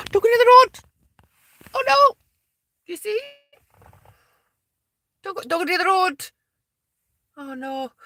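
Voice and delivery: high-pitched worried pleading; real alarm. Real, not acted. Woman warns rabbits off the road, high alarmed pleading. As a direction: plead with them in a high, anxious voice.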